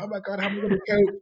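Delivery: a hint of overwhelmed dad panic; mock panic. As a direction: rattle it off like you're swamped. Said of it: "I was like".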